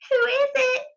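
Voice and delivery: put-on high old lady voice; old granny voice. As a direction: call out like a frail old granny. Granny calls through door.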